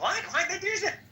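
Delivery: high, baffled disbelief; a squeaky mock double take; retold shock. Recalling his reaction to Eric's snub, high baffled. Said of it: "I was like".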